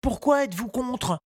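put-on high, polite little boy's voice; a small boy asking politely; young boy Tistou. A narrator's reading. Tistou asks the gardener, high child voice.

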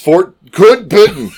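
high, loud announcement. Shown as a demonstration. Co-host repeating the term for the cheap seats, projecting loudly. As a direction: project it to the back row. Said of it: "oh, for the cheap seats?".

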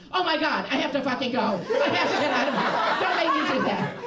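exaggerated pleading outburst; desperate protest. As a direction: beg to get out, hammed-up. Acted desperate plea to escape movie night.

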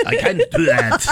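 high, laughing mock German accent; a hammed-up German take on an Australian voice; laughing through a bad German accent; German-sounding Australian. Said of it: "the German part of Australia?".

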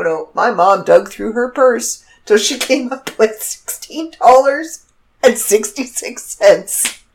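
ordinary talk, nothing marked

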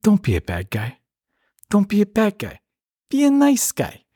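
rising into a high put-on voice; Steven Seagal movie line. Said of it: "say it the way it was said in the movie".

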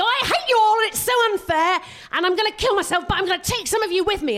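put-on high, loud whiny teen rant; hammed-up angry hurting teenager; mock teen yelling I hate you all; petulant American teen. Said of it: "they go".

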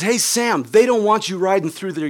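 high, loud retold taunt; taunting callout. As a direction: call the bully out from the porch. Acting friend taunting the bully.

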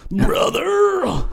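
very high, strained wrestler cry; Macho Man Randy Savage. Acted. Player testing character voice, hammed-up wrestler impression. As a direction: stretch it out like a wrestling promo. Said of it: "again, with the Macho Man".

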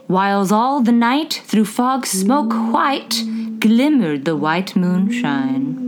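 slow, measured poem recital; poem reading. Acted. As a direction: recite it like an old ballad. Reads poem aloud.

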